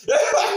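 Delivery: short, high real laugh; high burst of laughter. Real, not acted. Reactor cracking up at the buck fifty line, high laugh. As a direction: let a quick laugh pop out.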